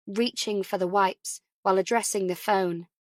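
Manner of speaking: ordinary delivery, nothing marked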